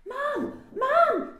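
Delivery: put-on high, loud child's call for Mum. A narrator's reading. Daisy calls Mum about a rhino, calling out. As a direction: shout urgently for your mother. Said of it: "Daisy called".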